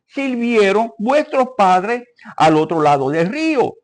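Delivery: scripture reading. Acted. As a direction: proclaim the verse to the congregation. Reads scripture aloud. (lectura bíblica solemne y proclamada)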